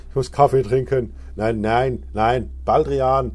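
hyper, loud outbursts; too much caffeine. Real, not acted. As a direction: wound up and blurting loudly. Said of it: "ich bin total aufgedreht".

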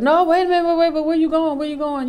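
high and loud, urgent calling back; excited calling out. Retold or imitated. Everybody calling her back as she leaves, loud and high.

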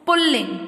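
"पुल्लिंग" with doubled l, "pul-ling"; correct pronunciation. Shown as a demonstration. Teacher showing what we say, correctly pronounced. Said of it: "क्या बोलते हैं इसको हम?".

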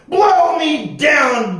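long, drawn-out high bellow; bellowing pirate. A narrator's reading. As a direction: bellow it slowly as an outraged pirate captain. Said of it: "bellowed Pete".

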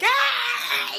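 high laughing shriek; laughing cry of boredom; mock scream. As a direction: let out a silly high scream. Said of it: "be like".

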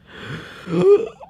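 quick loud intake of breath; sharp gasp. Shown as a demonstration. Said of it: "Does a little bit of breathing".

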